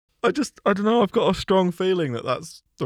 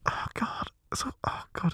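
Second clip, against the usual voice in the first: horrified whisper; 10 semitones higher, 10 dB quieter than usual. Real, not acted. Player horrified after the kill.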